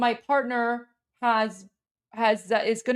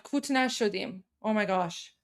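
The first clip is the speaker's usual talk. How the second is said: usual speaking voice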